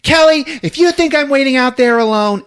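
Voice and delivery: loud, high yell from outside. Retold or imitated. Amy yells to Kelly from outside, loud and high. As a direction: call out after her from the car. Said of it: "you just hear Amy yell out".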